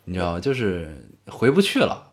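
usual speaking voice

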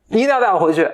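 put-on high, coaxing, babyish begging; cutesy whining plea. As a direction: pout and plead to be taken home. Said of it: "各种撒娇卖萌嘛".

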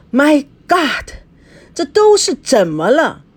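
tense exclamation. A narrator's reading. Boss left alone after the fight, exasperated. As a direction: cry out at the whole mess. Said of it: "他紧紧地握着拳说".

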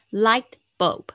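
Light bulb loud, the t of "Light" held back and swallowed; soft held T. Shown as a demonstration. Teacher modelling the word for students, loud with the t held back. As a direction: say "Light bulb" with the t bitten off before bulb. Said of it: "你会发现它那个T很轻".

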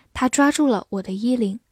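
normal talking voice

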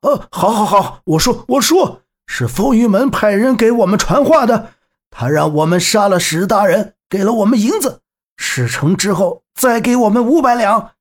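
high-pitched bandit hastening to confess; giving in. Acted. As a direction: blurt it out under threat. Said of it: "贼人们下定决心道". (贼人受胁迫，高声招供)

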